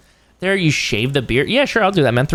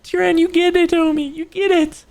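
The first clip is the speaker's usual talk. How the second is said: high squeal. Acted. High squealed reply to viewer.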